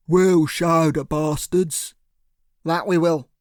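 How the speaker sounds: slow, heavy thug voice. A narrator's reading. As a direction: growl it like a loyal heavy. Cyril's thug voice.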